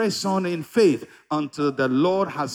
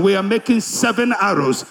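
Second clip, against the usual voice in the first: usual speaking voice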